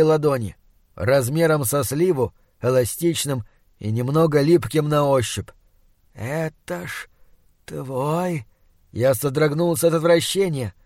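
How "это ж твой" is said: slow, hushed, rotting monster voice. Acted. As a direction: drag each word like a dying beast. Monster offers heart.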